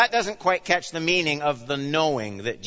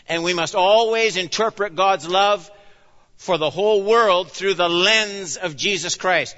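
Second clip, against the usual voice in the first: louder, higher, rising exhortation; full-force preaching; 5 semitones higher, 6 dB louder than usual. Acted. Pastor urging the church to rejoice, loud and rising. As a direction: urge them on with mounting force.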